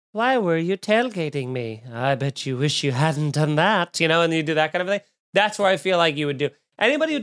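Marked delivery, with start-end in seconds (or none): 0.1-4.0 mock menacing killer, drawn out; slow psycho killer. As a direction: toy calmly with a trapped victim. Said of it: "that slow psycho killer".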